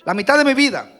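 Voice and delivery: loud fiery sermon delivery; preaching at full force. Acted. Pastor exhorting his congregation, loud and fervent. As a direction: preach it out with full fervor to the church.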